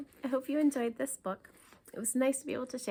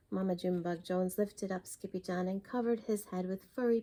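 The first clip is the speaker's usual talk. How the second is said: usual speaking voice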